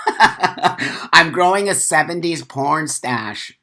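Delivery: slow, laughing through the line; chuckling as he shows off the stash. Real, not acted. Tells chat about his mustache, laughing slowly.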